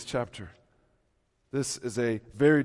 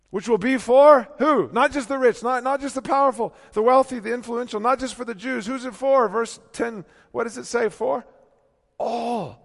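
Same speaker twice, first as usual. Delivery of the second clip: loud, high, ringing preacher's climb; full-voiced pulpit proclamation; preaching at full force; 12 semitones higher, 11 dB louder than usual. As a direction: lift it up and proclaim.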